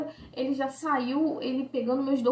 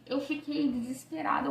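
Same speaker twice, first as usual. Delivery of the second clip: normal talking voice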